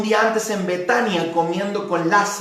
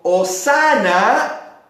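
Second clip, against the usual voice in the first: crowd acclamation; slower than usual. Retold or imitated. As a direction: call it out like the crowd hailing the king. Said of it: "clamaba diciendo".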